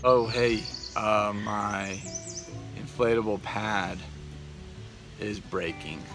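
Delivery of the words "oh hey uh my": ordinary talk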